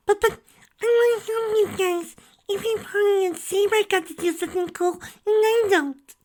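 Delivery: put-on tiny high whining child voice; whiny filly. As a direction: whine like a left-out kid. Dinky voice.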